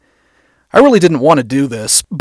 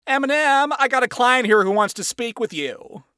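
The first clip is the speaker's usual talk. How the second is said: high-pitched boss calling his staff; wacky boss; 9 semitones higher, 5 dB quieter than usual. Acted. Boss announcing a client to his employees, wacky. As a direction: call out like a showy boss.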